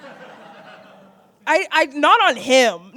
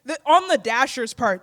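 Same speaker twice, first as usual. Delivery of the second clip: normal talking voice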